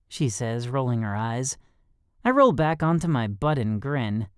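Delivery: usual speaking voice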